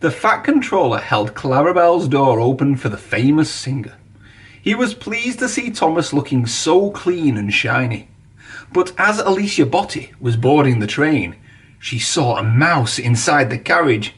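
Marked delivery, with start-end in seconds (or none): none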